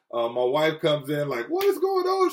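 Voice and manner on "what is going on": retold scream. Wife finding her husband on the floor, retold scream. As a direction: imitate a panicked wife in a high voice. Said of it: "She's screaming".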